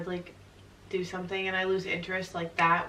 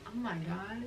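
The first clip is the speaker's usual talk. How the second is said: much softer, under-the-breath reaction; retold hushed awe; 11 dB quieter, slower than usual. Girlfriend retelling her first impression, hushed awe.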